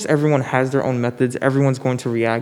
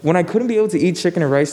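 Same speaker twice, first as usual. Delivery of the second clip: laughing; 6 semitones higher than usual. Real, not acted. Laughing at friend's joke.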